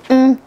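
a delighted mmm at the taste; savoring mm. Real, not acted. Tasting the beef rib.

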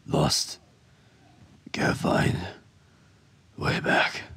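a faint, breathless whisper; fading whisper of a lost, dying man; weak whisper. A narrator's reading. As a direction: barely voice the words, lost and failing.

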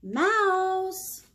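loud high call of a pet name. Acted. Mom calling her daughter by pet name, loud high call. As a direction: call her across the room.

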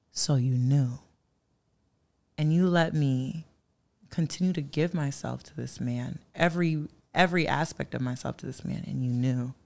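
low, soft, hurt reproach; re-enacted subdued, wounded accusation; hurt confrontation. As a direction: confront a friend with quiet betrayal. Confronts friend who hid the truth.

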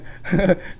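brief chuckle after an insult; scornful chuckle. Real, not acted. Streamer laughing at a troublesome commenter, scornful chuckle.

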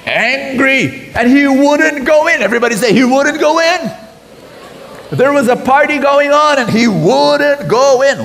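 heated preaching. A narrator's reading. Preacher telling the congregation the brother refused, heated. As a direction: build the drama of his refusal.

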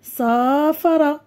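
slow, stretched first syllable in سافر; drawn-out teaching demo; split into syllables. Shown as a demonstration. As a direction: sound سافر out as sa-fa-ra. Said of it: "سنقوم بتجزيء الكلمات إلى مقاطع".

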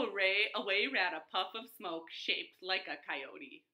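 usual speaking voice

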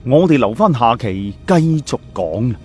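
ordinary delivery

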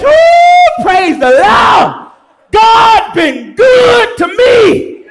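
high, loud, slow gushing praise; mock churchgoer praise. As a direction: gush like a happy churchgoer. Churchgoer praising after a raise.

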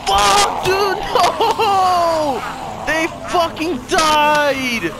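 high, grief-struck shouting; anguished wail. Real, not acted. Gamer reacting to two deaths in the game, anguished wail. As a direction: wail it out, drawing words long.

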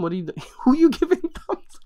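high-pitched mock scolding broken by laughter; cracking up mid-quote. Mocking his thumbs up, laughing through the scolding. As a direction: start telling him off, burst out laughing.

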